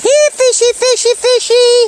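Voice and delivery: high sing-song calling; talking to fish. Real, not acted. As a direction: coax the fish, high and loud.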